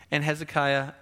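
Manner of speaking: ordinary delivery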